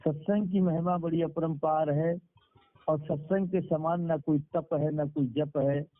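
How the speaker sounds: normal talking voice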